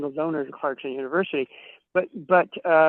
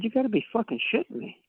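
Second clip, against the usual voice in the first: pitched-up, fast stunned outburst; retold disbelief; 7 semitones higher, 4 dB quieter, faster than usual. Retelling his reply to the provost marshal, in high, fast disbelief. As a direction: blurt it out like you can't believe it.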